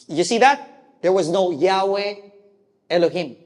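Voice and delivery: ordinary talk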